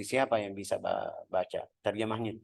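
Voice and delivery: ordinary talk, nothing marked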